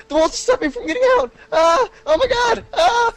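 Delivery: high, loud hammed-up dying scream; mock terror. The hostage stuck in the Warthog, hammed-up high scream. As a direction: wail like you are trapped and doomed. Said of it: "Hostage said".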